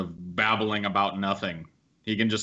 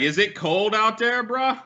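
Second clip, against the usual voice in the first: mocking question; 10 semitones higher than usual. Mocking a speaker on video.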